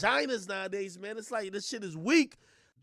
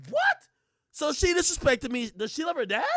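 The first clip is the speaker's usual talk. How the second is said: louder, higher incredulous comeback; indignant retort; 9 semitones higher, 6 dB louder than usual. Acted. Man answering the love-your-mom charge, indignant. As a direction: throw the question back, raised voice.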